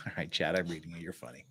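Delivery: breathy whispered reply to chat. Real, not acted. Streamer whispering a reply to Chad in chat. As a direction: hush it down to a whisper.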